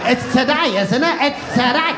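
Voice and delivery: high, pleading mock question; a confused reveller in fancy dress asking the date; confused Halloween fan. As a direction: whine it up high, unsure of the date. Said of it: "dressed as Freddy Krueger going".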